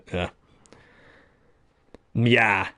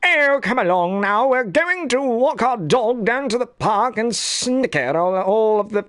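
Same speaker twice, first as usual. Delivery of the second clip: high, fancy-pants British cartoon voice; snooty British gent looking down on peasants; cartoon British accent; 10 semitones higher than usual. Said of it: "like a British kind of accent voice".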